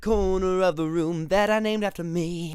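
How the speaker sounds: silly host voice. Pretend. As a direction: ham up a fake talk show host. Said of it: "I'm gonna talk like this the entire time".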